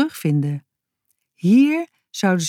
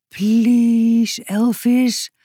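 pleading; 5 semitones higher, much slower than usual. A narrator's reading. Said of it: "smeekte Boutje".

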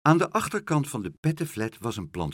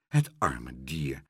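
low, slow and sorry; pitying; 9 semitones lower, slower than usual. A narrator's reading. Boy pitying a drowned horse, sorrowful. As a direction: say it slowly, low with pity.

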